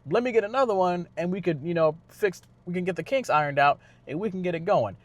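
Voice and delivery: lightly hammed-up cocky driver shrugging it off; cocky upbeat racer. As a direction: breezily brush off the crash. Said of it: "But he's like".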